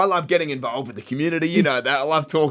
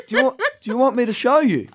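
put-on higher, eager small-kid voice; imitated little boy; 7 semitones higher than usual. Boy offers a scooter trick, eager child voice. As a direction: play a five-year-old offering to show off. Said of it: "he's like".

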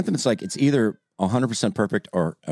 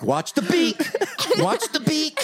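mock hummingbird voice. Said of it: "You're like".

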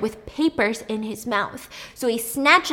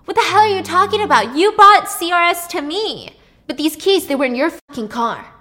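angry shouting; 8 semitones higher, 9 dB louder than usual. Retold or imitated. Brothers shouting argument.

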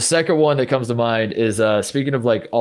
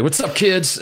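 higher, mock-cool greeting; backwards-hat TV guy; 7 semitones higher, slower than usual. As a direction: imitate an extreme-sports TV bro. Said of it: "guys with backwards hats showing up".

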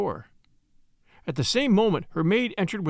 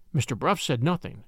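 usual speaking voice

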